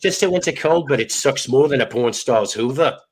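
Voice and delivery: a hammed-up fake British accent. Reading a viewer's greeting to the stream in a fake British accent. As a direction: put on a mock British accent to read the greeting. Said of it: "in my fake British accent".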